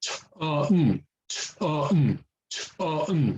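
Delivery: "ch, ah, n" split into separate sounds; airy "ch", open "ah", hummed "n", one by one; sounding it out. Shown as a demonstration. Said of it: "like that".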